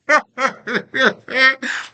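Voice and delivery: a burst of high laughter; bright amused laugh. Real, not acted. Laughs at own line.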